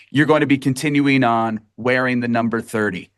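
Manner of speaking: ordinary delivery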